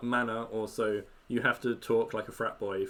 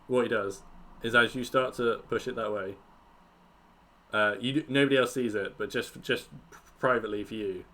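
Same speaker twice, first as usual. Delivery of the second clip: ordinary talk, nothing marked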